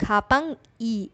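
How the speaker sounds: खापंग ई said slowly, with a gap between खापंग and ई; slow, separated. Shown as a demonstration. Teacher showing students the slow, separate खापंग ई. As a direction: keep खापंग and ई apart, unhurried. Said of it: "एक साथ speed में जल्दी से बोलेंगे".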